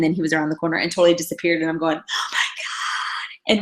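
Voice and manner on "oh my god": slow breathy oh my God; hushed shock; stunned whisper. Retold or imitated. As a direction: whisper it in disbelief. Stunned he vanished.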